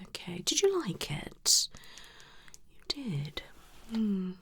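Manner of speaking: ordinary delivery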